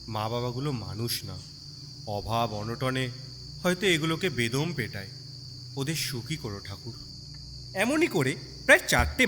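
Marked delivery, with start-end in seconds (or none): none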